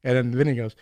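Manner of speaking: usual speaking voice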